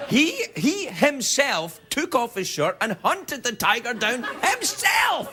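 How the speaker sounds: rising to a high slow shout; building excitement. Acted. Host tells of Putin hunting the tiger, building to a shout. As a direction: build up to the big finish.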